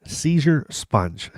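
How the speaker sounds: Seizure sponge drawn out slow, each word apart; deadpan slow reading of the typo; slowly spelled out. Shown as a demonstration. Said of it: "Seizure, like seizure".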